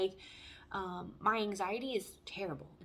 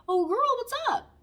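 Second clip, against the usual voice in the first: much higher and louder, a hearty dad greeting; imitating dad; 14 semitones higher, 10 dB louder than usual. Daughter imitating her dad answering her call, a hearty higher, louder greeting. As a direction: boom out dad's cheerful hello. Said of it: "he'll be like".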